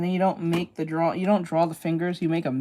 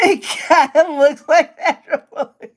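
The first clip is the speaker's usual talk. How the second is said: higher, louder, slow, through laughter; laughing; 9 semitones higher, 8 dB louder, slower than usual. Real, not acted. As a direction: laugh while saying it. Laughing at the lookalike.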